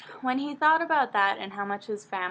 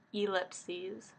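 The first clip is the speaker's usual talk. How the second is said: usual speaking voice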